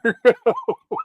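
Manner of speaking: laughing. Real, not acted. High burst of laughter.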